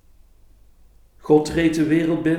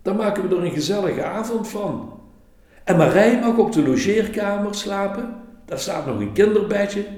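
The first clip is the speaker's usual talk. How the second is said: put-on higher, beaming welcome of a child innkeeper; happy innkeeper; 9 semitones higher, 5 dB louder than usual. A narrator's reading. Child innkeeper invites the tired couple in, gleeful and high. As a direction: sweep them inside with joy.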